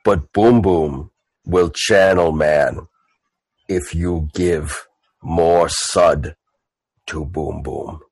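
low, slow, simple brute; Boom Boom. Acted. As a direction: drag it out deep and halting.

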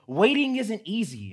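put-on a five-year-old quoting the book smugly; cheeky child voice. Son Lennox twisting the book's moral, cheeky kid voice. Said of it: "he's like".

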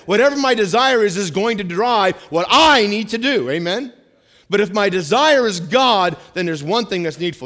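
congregation-stirring preacher's shout; full-force preaching. Acted. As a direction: build the sermon to a roar. Preacher building to a loud climax.

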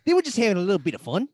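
jokey Australian lilt, rising at the end; bad Australian accent. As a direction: put on a dodgy Aussie accent. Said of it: "You guys are bad at accents".

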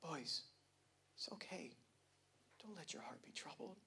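soft whispered comfort, "Don't" barely breathed; soothing whispered reassurance to his boys; gentle whisper. Retold or imitated. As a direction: hush it like a gentle, passive Jesus. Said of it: "this passive, gentle Jesus saying it like".

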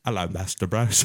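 Michael Caine. Acted. Butler greeting his master, in a put-on British voice. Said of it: "fucking Michael Caine".

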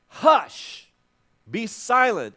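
loud, high "Hush", then a firm "Be silent"; Jesus rebuking the storm at full voice; booming command. Acted. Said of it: "he commands the storm".

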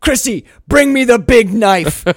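loud, high, melodramatic demand; mock screaming rage. Nicolas Cage ordering the shop girl, hammed-up screaming rage. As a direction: yell the order like an overwrought tragic hero. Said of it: "He's screaming his rage at his missing hand".

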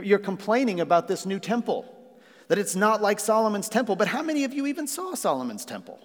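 high-pitched, challenging rebuke; incredulous challenge. Acted. God to people complaining about the temple, challenging.

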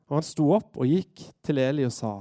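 normal talking voice

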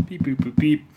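mouthed phone beeps; playful keypad beeps, sung out; mock phone dialing. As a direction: beep out the dialing tones like a keypad. Dialing the police.

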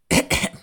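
loud, short double cough; throat clearing. Real, not acted. Host about to read a question, loud throat clearing.

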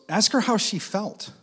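high, loud, drawn-out prompt; acted-out prompt. Mentor's whispered prompt acted out.

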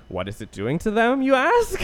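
theatrical tease, swooping way up on "you ask"; mock rhetorical question. Storyteller voices the listener's question about Solaris, mock-dramatic.

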